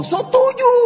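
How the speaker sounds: high mock crowd reply; crowd shout. Crowd answering the MC, mock shouted.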